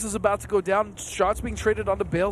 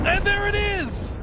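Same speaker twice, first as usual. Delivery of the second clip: slow, high, triumphant call; excited call; 11 semitones higher, slower than usual. Real, not acted. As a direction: land the big moment with delight. Caster calls the kill.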